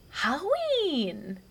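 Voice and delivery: put-on high, very slow toddler "Halloween"; imitating toddler. Mom imitates her toddler saying Halloween. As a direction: draw it out like a proud little girl. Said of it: "she goes".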